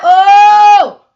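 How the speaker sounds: a loud, high cry of joy at Lord; overwhelmed exclaim. Retold or imitated. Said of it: "And I be like".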